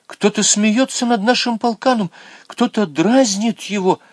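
put-on high girl's voice, upset; indignant girl. A narrator's reading. Girl protests that someone teases the goat, indignant. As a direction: defend the goat, high and hurt.

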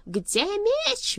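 high, loud furious roar. A narrator's reading. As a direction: roar the demand. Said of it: "взревел Сагород".

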